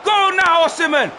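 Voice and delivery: high, loud frantic cheering; excited shouting. Real, not acted. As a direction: roar him on toward goal. Cheering a striker through on goal.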